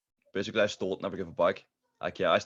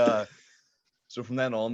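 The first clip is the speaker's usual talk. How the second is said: usual speaking voice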